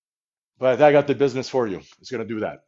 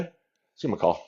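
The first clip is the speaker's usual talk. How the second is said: usual speaking voice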